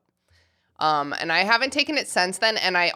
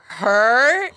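louder, higher questioning blurt; exclaimed catchphrase; 4 semitones higher, 5 dB louder than usual. Retold or imitated. Host quoting the drinking game rule, exclaimed catchphrase. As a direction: swoop upward on the one word. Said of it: "you go".

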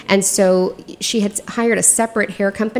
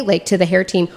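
ordinary delivery

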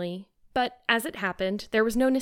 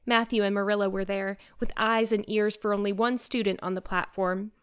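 ordinary delivery, nothing marked

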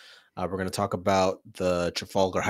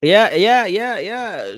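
excited; 13 semitones higher, 10 dB louder, much slower than usual. Real, not acted. Excited agreement about aunt.

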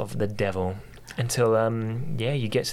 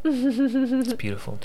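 gushing; 14 semitones higher, slower than usual. Real, not acted. Gushing about the game.

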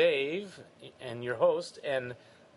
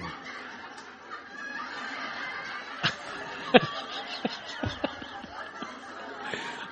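big laugh; 4 dB quieter than usual. Real, not acted. Host laughs hard at joke.

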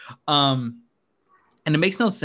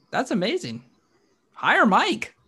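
excited; 11 semitones higher than usual. Real, not acted. Raving about obscure Division III coach.

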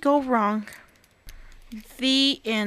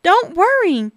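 breathy, higher hurried reassurance; gasping; 7 semitones higher, 5 dB louder than usual. Acted. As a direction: gasp it out, flustered. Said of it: "gasped Bob".

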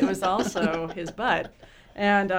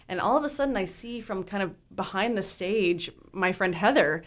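ordinary delivery

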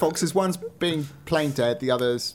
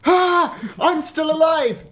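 much higher and louder triumphant cry; hammed-up dead fox crying out, higher and louder; mock triumphant shout; 12 semitones higher, 5 dB louder, slower than usual. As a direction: burst up from the dead. Acting old fox waking.

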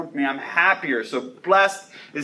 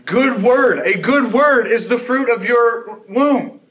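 louder, higher, slow proclamation; loud cry; 7 semitones higher, 6 dB louder, slower than usual. Acted. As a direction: cry it out slowly, with joy. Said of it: "And then she says".

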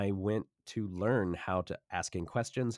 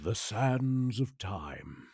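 very slow, drawn-out sands of time; epic narrator; much slower than usual. Pretend. Guest closing his riff as a grand narrator. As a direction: savour each word like a trailer voice. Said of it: "Okay, I'm done".

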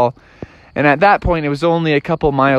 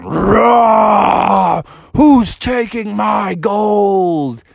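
slow, higher roared bear voice; roaring bear; 6 semitones higher, much slower than usual. Acted. Bear demands his gold back, long roar. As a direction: roar out each word like a big bear. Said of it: "hear a big bear roar going".

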